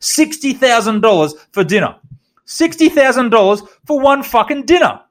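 high, loud mock tirade that builds; imitated yelling boss. Boss shouting at staff about the dinner bill, mock outrage. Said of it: "he's going".

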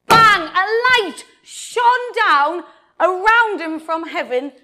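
loud, high burst after a hush; sudden bang. Acted. Preacher telling children of the light from heaven, sudden loud burst. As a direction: startle them with a booming storyteller's outburst. Sudden climax of Bible story.

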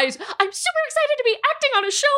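very high, hammed-up eagerness; mock a young performer thrilled to be acting; super-excited show voice. Actress mimicking her younger self on the show, super-excited voice.

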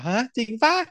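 retold surprise pitched well up; a high, disbelieving question; surprised. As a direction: bandmates doubting he is really back, mock surprise pitched high. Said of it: "ทุกคนก็หันมาทำสายตาแบบว่า".

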